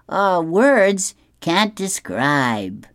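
slow, high, lightly acted diplomatic praise; tactful. A narrator's reading. Boyfriend answering about her new hair, tactful. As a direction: draw it out, carefully tactful. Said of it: "he's savvy and tactful".

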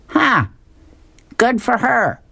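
high-pitched sidekick scoff; high cartoon voice. Fillmore answers Sherman.

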